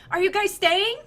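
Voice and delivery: put-on very high, excited child's squeal; squealing little girl. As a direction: gush like a thrilled kid seeing old friends. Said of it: "she's just kind of like".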